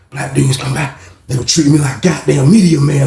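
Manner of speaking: usual speaking voice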